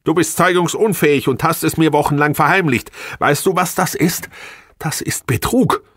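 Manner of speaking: high, loud accusing tirade; outraged. A narrator's reading. Wife threatening husband with the court, outraged. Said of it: "rief sie empört aus".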